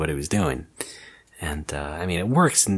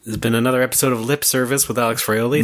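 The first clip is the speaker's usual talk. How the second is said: announcer sign-off; 3 semitones higher, faster than usual. Pretend. Mock podcast sign-off.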